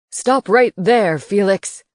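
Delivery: loud, slow booming order; a professor booming at Felix; booming command. A narrator's reading. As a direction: boom it from behind. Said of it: "a voice boomed".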